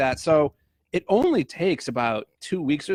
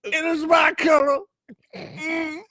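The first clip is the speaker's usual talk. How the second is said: Bill Cosby impression; 12 semitones higher, slower than usual. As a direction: squeal it with pride. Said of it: "I could do a great Bill Cosby".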